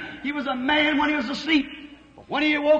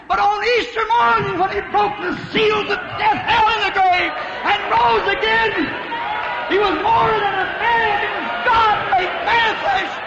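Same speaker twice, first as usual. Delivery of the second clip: higher and higher, proclaiming the resurrection; building preaching climax; 5 semitones higher than usual. Real, not acted. Preacher to the congregation about Easter, rising triumphant proclamation. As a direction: lift the whole room to Easter morning.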